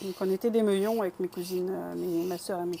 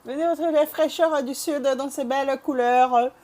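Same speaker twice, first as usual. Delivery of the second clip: clumsy attempt at a southern French accent; louder, slightly raised southern French lilt; 4 semitones higher, 6 dB louder than usual. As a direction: do a rough Midi accent, market-pitch style. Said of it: "Je sais pas parler avec l'accent du sud".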